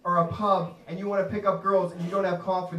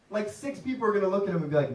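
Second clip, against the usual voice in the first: normal talking voice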